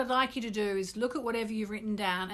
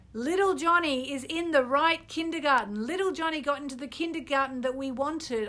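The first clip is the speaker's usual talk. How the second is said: joyful affirmation; 6 semitones higher, 5 dB louder than usual. Shown as a demonstration. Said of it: "Imagine you're writing to someone".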